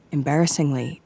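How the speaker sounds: ordinary delivery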